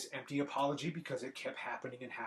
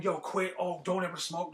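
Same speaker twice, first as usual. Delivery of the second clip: higher, louder mock lecture; mock preaching; 5 semitones higher, 4 dB louder than usual. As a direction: imitate someone urging others to quit. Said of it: "I'm not preaching to you".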